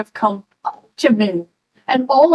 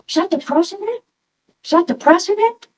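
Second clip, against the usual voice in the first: high-pitched, rising excited question; eager questioning; 9 semitones higher, faster than usual. Retold or imitated. Tourist asks the officer about the president, eagerly. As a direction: ask eagerly, voice climbing right up at the end.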